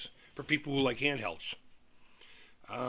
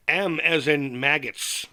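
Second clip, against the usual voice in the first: M as in maggots, slow, louder, M punched; spelling out the letter; 6 dB louder, slower than usual. Shown as a demonstration. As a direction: spell it out firmly and slowly, hitting M.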